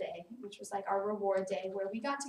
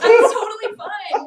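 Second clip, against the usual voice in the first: imitating a laugh-cry, higher and much louder; forced cheerful laugh-cry; 9 semitones higher, 15 dB louder than usual. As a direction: fake brave face through tears, half laughing. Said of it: "you're, like, laugh crying".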